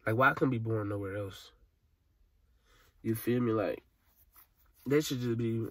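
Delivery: choking back tears over his city; crying. Real, not acted. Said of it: "y'all might see me crying just like now".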